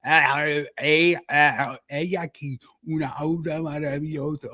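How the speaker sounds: exaggerated vocal exercise; playfully overdone Spanish warm-up; actor warm-up. Shown as a demonstration. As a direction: loosen the mouth with overdone vowels. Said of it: "crazy actor warm-ups".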